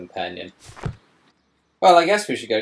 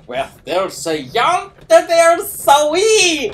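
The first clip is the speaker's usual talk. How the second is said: booming mock Scottish accent, much higher, louder and slow; 10 dB louder, much slower than usual. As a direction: bellow it like a hammy Scotsman, dragging out "way". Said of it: "That's my Scottish accent".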